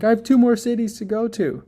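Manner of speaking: high, anxious self-quote; high mock-panicked cry about the trip; panicked inner voice.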